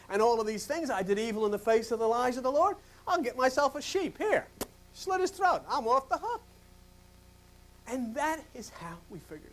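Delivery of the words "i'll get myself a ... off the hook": mock carefree sinner. Sinner's smug plan voiced.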